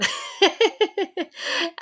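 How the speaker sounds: short rueful laugh that falls away; wry laugh at the failure. Real, not acted. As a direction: laugh at yourself.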